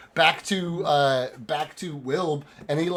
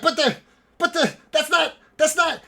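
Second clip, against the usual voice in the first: high, sputtering objection; stammer, frantic and baffled; agitated broken phrases; insane elf; 14 semitones higher, 4 dB louder than usual. Acted. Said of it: "fucking insane elf".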